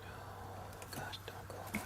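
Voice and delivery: hushed whisper, no voice at all. Real, not acted. Investigator whispering to a spirit not to leave, hushed whisper. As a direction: breathe it so the spirit stays.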